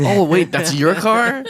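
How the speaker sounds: mock surprise at the strawberry car; surprised. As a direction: sound stunned at the car. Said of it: "I was like".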